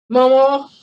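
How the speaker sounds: loud, high call of the name Momo; sharp call. Retold or imitated. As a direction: call her out, accusing. Calling the one left in charge.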